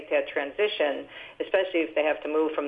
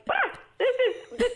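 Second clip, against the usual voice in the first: delighted gasp. Retold or imitated. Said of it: "And I'm like".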